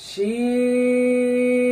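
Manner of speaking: long sustained শিন, open sh sound; Arabic letter name. Shown as a demonstration. Teacher models শিন for students, slow recitation. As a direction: stretch শিন, teeth apart. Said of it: "এভাবে ধরবেন".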